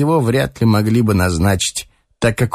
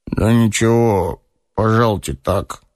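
sleepy orderly. A narrator's reading. Sleepy orderly lets an officer in, lazily. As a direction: mumble it as if half asleep. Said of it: "ленивый, заспанный денщик".

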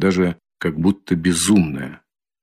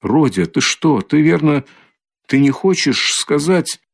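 higher, hesitant alarm; frightened; 7 semitones higher than usual. A narrator's reading. Mother starting to question Rodya about Luzhin, frightened. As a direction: raise the pitch and falter in mild fright. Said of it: "начала было в испуге".

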